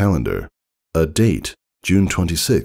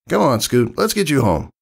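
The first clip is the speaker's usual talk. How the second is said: higher, easy big-sister call; friendly tomboy; 10 semitones higher than usual. Acted. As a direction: round up the kid to head home. Rainbow Dash voiced in story.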